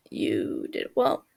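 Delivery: deep, slow, steady praise; low calm approval. Acted. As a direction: say it low and level like a stern older brother.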